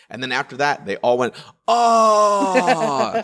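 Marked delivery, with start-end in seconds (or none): 1.7-3.2 a long, high, loud letdown "oh"; disappointed crowd. Retold or imitated. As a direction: groan like a whole room whose illusion just broke.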